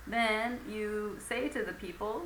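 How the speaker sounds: usual speaking voice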